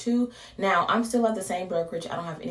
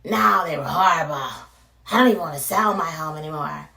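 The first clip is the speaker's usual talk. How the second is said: grumpy homeowner; 6 dB louder, faster than usual. Retold or imitated. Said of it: "they aren't gonna use".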